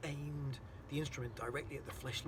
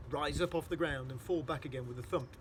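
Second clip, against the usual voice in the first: ordinary delivery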